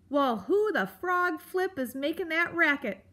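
high, loud grumpy creature; blustering, testy question; irritated demand. Acted. Grump demands who is making the racket, irritated.

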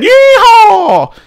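loud, high rodeo holler; cowboy yeehaw. Acted. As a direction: whoop like a cowboy. Cowboy whoop after win.

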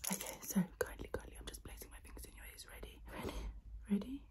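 close-mic whisper, guiding the listener; ASMR whisper. Acted. Whispering to the viewer during an ASMR roleplay.

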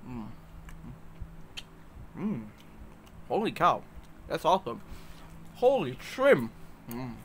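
talking around chocolate; chewing while talking. Real, not acted. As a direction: mouth partly full. Seen 1: Piece put in mouth at -4; jaw and lips chew while speaking.

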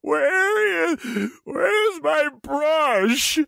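put-on high, slow whiny teen girl; mock dramatic daughter. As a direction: ham up a teen in distress. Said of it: "it's so dramatic".

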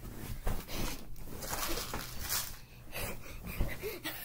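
long breathy laugh. Real, not acted. Laughing at the spin.

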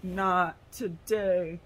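slow, deadpan robot voice, drawn out; robot hero line. Retold or imitated. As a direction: deliver it like a calm movie robot. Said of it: "and he's like".

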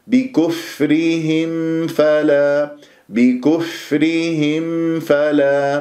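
بكفرهم فلا very slow, fa breathed clearly; clear faa. Shown as a demonstration. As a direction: let air flow on the fa of بكفرهم. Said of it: "بهذه الطريقة".

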